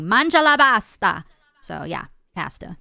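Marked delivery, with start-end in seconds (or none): 0.0-1.2 loud, higher, hammed-up Italian accent; Italian exclamation. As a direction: belt it out like an exuberant Italian. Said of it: "it's actually an Italian thing".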